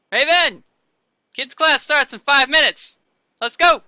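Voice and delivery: loud, high call across the mats; brisk, commanding shout. Acted. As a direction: holler it like an instructor rounding someone up. Instructor calls across the dojo.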